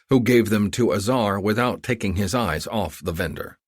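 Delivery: plain reading voice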